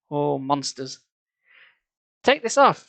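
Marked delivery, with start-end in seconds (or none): 2.2-2.9 high, loud frantic scream; high panicked shout. Acted. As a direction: scream it out, losing control. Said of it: "she screamed".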